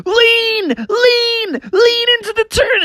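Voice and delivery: squealing urging on a wild ride; panicked cub shouting to lean; high excited yell. Acted. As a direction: yell it high as the wagon tips. Bubby yelling on the turn.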